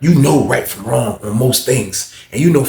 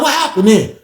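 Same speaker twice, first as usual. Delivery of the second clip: high-pitched mock guard question; questioning officer; 12 semitones higher, 4 dB louder than usual. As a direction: press the inmate for an answer. Imitates officer pressing for answer.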